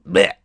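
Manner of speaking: loud blurting noise; sudden loud "bleh"; blurted "bleh". Retold or imitated. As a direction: blurt it out like verbal spew. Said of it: "just blurt out what they wanna say".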